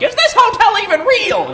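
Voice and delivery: very high, loud mock panic; panicked squeal. Hotel guest at check-in, squealing in mock panic. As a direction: squeal like a guest in sudden doubt. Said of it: "I'm like".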